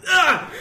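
high sharp cry of pain; pained yelp. Acted. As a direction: yelp after poking your eye. Pokes himself in eye.

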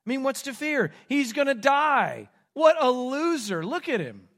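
scornful jeering. Acted. Soldiers with nothing to fear from the condemned man, jeering. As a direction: jeer at him, then drop away.

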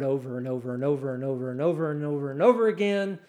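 fast, unbroken string of repeats; rapid repetition. Retold or imitated. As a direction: rattle it off in a fast, running chant. Mimics repetitive advice.

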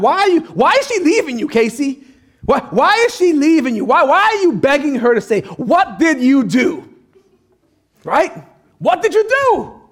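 high-pitched, insistent questioning; mock incredulous grilling. Preacher grilling the singer about being left, mock-incredulous. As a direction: press each question with comic disbelief.

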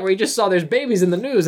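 ordinary delivery

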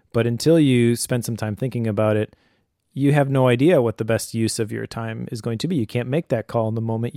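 ordinary talk

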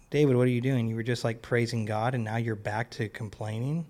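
normal talking voice

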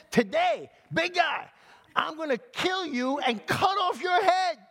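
very high, shouted threat; kid's defiant shout. Acted. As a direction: yell up at a giant like a bold little kid. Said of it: "This little kid".

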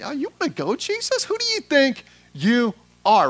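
high-pitched taunt building to a loud challenge; squaring up. Acted. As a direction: step up and dare them to fight. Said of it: "stepping up and saying".

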